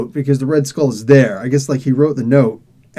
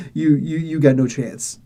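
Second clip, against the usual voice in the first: villain laugh. Acted. Villain Dormammu threatens.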